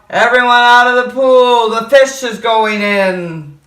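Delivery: loud, commanding shout; fireman's shout. Acted. Fireman orders swimmers out, loud shout. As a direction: clear the pool like a fireman. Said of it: "the fireman yelled".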